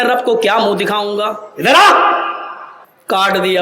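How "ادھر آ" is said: high, loud call; commanding shout. Retold or imitated. Umar summoning the man before cutting his garment, loud command. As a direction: order him to come here.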